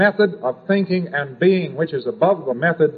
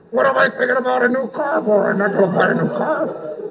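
higher, impatient self-rebuke; self-scolding; 10 semitones higher than usual. Pretend. Walker catching himself dreaming of a new car, impatient self-rebuke. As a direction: snap at yourself for the silly thought.

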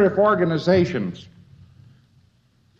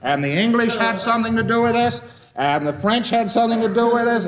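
raised-pitch objection, lightly acted; protesting American; 7 semitones higher than usual. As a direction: insist the others share the blame. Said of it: "You say".

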